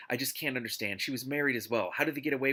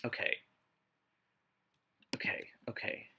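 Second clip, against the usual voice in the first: whisper; 3 semitones lower, 5 dB quieter than usual. Real, not acted. Bracing herself before reading on.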